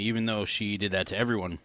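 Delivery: plain reading voice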